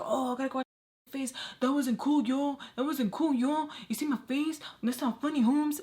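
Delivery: high-pitched mock complaining; hammed-up high protest with rising ends; offended guy impression. Acts offended man's complaint.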